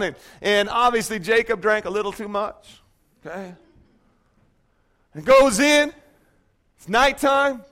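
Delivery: usual speaking voice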